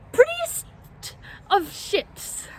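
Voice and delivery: high-pitched, sarcastic emphasis; mock it in a squeaky, prissy lift; sarcastically sweet and high; mocking sneer. Sneers at classmates' ship ambitions.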